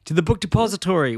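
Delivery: high, eager mock proclamation; mock rallying cry. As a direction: quote it as a lighter battle cry. Repeats the cartoon rallying cry.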